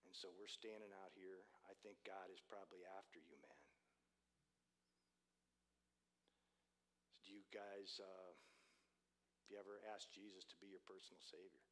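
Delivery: low, soft, careful voice; gentle invitation. Retold or imitated. Preacher to stranded couple about faith, low and gentle. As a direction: ease into it softly and tenderly.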